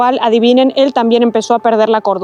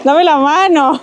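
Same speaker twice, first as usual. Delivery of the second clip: nervous plea; scared; 3 semitones higher, slower than usual. Real, not acted. Hiker begging companion for a hand, scared. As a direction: ask anxiously for a helping hand. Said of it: "que me da miedo".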